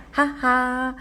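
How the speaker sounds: high, spoken "ha ha"; mock laugh of a victorious kid; acted triumphant laugh. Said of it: "친구가".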